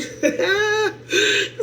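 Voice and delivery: high, breaking laugh tipping into tears; real tearful giggle of gratitude; overjoyed laugh-cry. Real, not acted. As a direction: laugh and cry at once from joy. Overjoyed at gifts.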